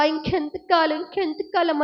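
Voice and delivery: usual speaking voice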